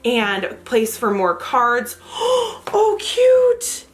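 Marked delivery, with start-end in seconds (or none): 2.0-2.7 sharp, high intake of breath; delighted gasp. Real, not acted. Vlogger finding a cute item in the box, delighted gasp. 2.7-3.9 high, thrilled coo; delighted squeal. Real, not acted. Vlogger reacting to a cute unboxed item, delighted.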